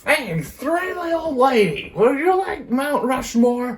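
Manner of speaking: high, pushy salesman pitch; mock salesman voice. Pitching Mount Rushmore to an old lady, high huckster voice. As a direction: sell it high and eager like a huckster.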